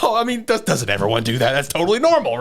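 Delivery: high, flustered, overly casual; flustered cover story. Retold or imitated. Shinpei caught by Mio, high flustered excuse. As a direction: bluff nervously, voice jumping up. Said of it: "Shinpei's like".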